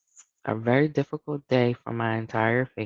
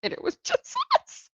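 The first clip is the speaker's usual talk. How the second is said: high, giggling through the words; can barely get it out for laughing; real laughter breaking through the story. Real, not acted. Laughing at the memory.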